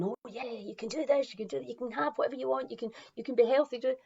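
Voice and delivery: fast, chirpy pep talk; upbeat cheerleading. Retold or imitated. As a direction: gush encouragement from way up high. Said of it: "somebody like me starting off up here going".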